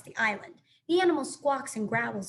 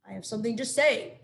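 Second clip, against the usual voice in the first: louder, slightly hooty owl voice; hooting owl; 5 dB louder than usual. A narrator's reading. Owl calling the animal crowd to order, hooting. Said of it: "hooted to the crowd".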